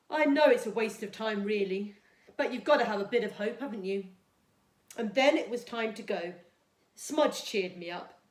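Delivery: put-on storytime reading for young children; gentle storybook read-aloud for Year two; reading a story. A narrator's reading.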